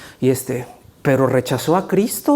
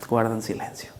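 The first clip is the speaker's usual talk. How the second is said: much softer, near silence; hushed; 5 semitones lower, 10 dB quieter than usual. Acted. Preacher hushing the congregation, much softer. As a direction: drop the voice away.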